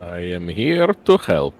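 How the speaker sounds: deliberate, slowing foreign-sounding greeting; big gentle cat warrior's careful hello; friendly cat-man. Acted. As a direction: introduce yourself simply and earnestly, word by word. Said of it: "smiles at everyone and says".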